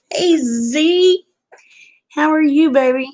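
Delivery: high, bright sweet hello; affectionate greeting. Real, not acted. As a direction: greet a friend fondly.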